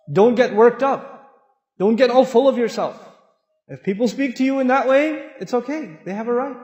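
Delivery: ordinary talk, nothing marked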